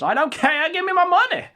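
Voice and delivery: high, loud screeching demand; greedy yell. Acted. Ethan Ralph at Gator's grave, shrieking for his money. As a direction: shriek for the cash like a spoiled brat.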